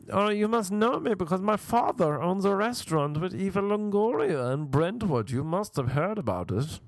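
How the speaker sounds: high, affected mock-English boasting; name-drop grandly in a put-on theatrical voice; theatrical name-dropper. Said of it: "this guy actually speaks like this".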